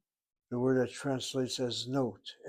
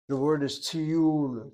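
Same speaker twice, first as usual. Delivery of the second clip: tiyul stressed on its last syllable, slow and louder; stress on second syllable; 3 semitones higher, 5 dB louder, slower than usual. Shown as a demonstration. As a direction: stretch and push the -yul of tiyul. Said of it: "It's the second syllable".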